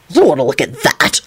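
low, disgusted refusal. A narrator's reading. Lupin won't look at naked George, disgusted. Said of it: "Lupin said disgustedly".

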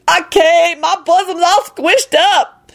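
high, wailing woman; panicking Lucretia voice. A narrator's reading. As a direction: protest helplessly, way up high.

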